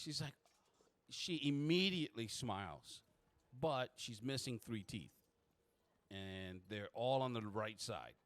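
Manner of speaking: normal talking voice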